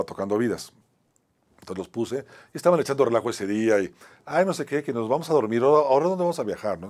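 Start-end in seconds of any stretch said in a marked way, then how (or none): 4.3-6.8 higher-pitched mock whining of joking students; playful, raised-pitch mimicry; imitating mocking students. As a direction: goof around like students not taking it seriously. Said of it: "Entonces estaban como burlando".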